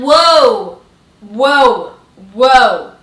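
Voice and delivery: loud, falling whoas; whoa-ing loudly. Retold or imitated. She backs off from the teasing driver, loud. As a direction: back off, loud and laughing. Backing off in the cab.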